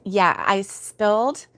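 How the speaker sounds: ordinary talk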